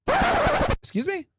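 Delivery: squeaky high, stretched-out excuse me; a clerk who can't believe his ears; shocked disbelief. Retold or imitated. Shocked clerk on prank call.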